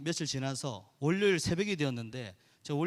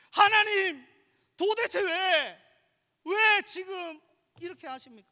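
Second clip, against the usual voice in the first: high, loud anguished cry to God, breaking off at the end; 14 semitones higher, 8 dB louder, slower than usual. Retold or imitated. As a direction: plead with God through tears, drawn out. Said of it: "울면서 운전하는 그거".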